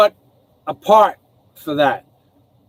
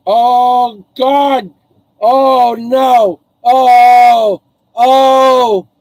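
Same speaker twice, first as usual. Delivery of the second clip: loud, high cries of alarm; real panicked shouts as the dog leaps the fence; 4 semitones higher, 6 dB louder, much slower than usual. Real, not acted. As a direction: yell in real dismay. Pet about to get hurt.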